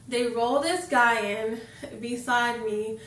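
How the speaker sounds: ordinary talk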